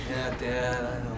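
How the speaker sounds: low, soft retold reply; meek kid. Son conceding to dad, quietly imitated meekness. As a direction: imitate a subdued boy giving in. Chastened boy answers dad.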